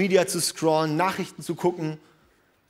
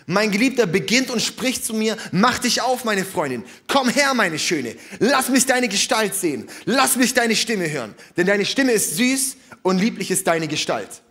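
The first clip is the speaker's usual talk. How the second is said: raised, fervent reading of the verse; preacher reading scripture aloud; 3 semitones higher than usual. Acted. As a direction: read the love verse with rising fervour. Preacher reads Song of Songs.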